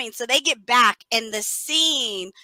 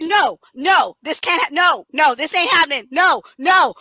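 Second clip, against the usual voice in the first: re-enacted string of protesting no's; raised-voice pleading at the screen; yelling at the movie; 4 semitones higher, 4 dB louder than usual. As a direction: refuse to accept it, each "no" punched out. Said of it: "I was yelling at the movie".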